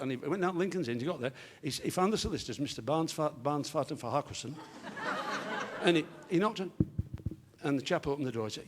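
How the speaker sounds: normal talking voice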